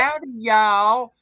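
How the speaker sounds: slow, high stretched Southern drawl. Retold or imitated. Mocking a Southern greeting in a slow Southern drawl. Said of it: "that long Southern drawl".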